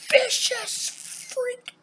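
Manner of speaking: very high, loud and dragged out; shrieking warning. Acted. Telling viewers never to anger a female, slow high screech. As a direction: screech each word slowly, like a warning wail.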